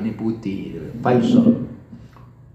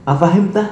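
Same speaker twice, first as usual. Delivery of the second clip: "Afahimta" high and louder, ending upward; "Afahimta" as a raised, emphatic question; rising question intonation; 8 semitones higher, 5 dB louder, faster than usual. Shown as a demonstration. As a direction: ask "Afahimta" with pitch climbing. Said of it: "intonasinya naik".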